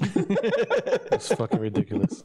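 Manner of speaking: slow, high, laughing through the words; can't keep a straight face. Real, not acted. Mock denial, bursting into laughter.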